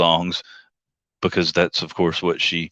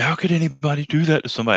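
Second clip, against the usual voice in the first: indignant quote; 8 semitones higher, much faster than usual. Retold or imitated. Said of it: "She was like".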